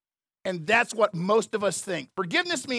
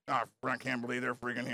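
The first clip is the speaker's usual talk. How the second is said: muttering under breath; 10 semitones lower, 11 dB quieter than usual. Retold or imitated. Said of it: "you're gonna be like".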